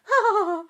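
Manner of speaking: loud, high fake sobbing wail; hammed-up boo-hoo cry; mock sob. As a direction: imitate someone sobbing dramatically. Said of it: "Just sobbing".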